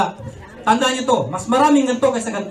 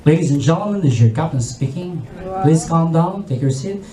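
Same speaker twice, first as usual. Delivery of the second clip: much lower, composed pilot announcement; calm captain; 7 semitones lower than usual. Retold or imitated. Captain calming panicking passengers, low composed voice. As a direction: reassure the passengers like a steady captain. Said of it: "sabi nyo".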